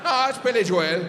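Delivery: loud, high "Billy Jo-el", insisting; Irish pronunciation. Retold or imitated. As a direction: shout back "Jo-el". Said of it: "And he goes".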